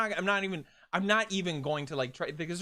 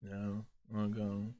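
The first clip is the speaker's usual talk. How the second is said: sulky low, soft murmur; mock-dejected, barely bothering; unenthused; 9 semitones lower, 6 dB quieter than usual. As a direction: pretend to be totally drained of enthusiasm. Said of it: "unenthused [name] over here".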